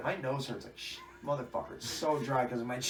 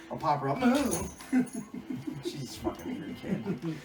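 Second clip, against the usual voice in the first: ordinary talk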